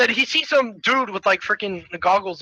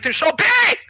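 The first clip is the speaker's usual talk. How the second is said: raised, rising exclamation; excited emphasis; 12 semitones higher, 3 dB louder than usual. Real, not acted. Friend explaining the thick coins, excited emphasis. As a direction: marvel at the size.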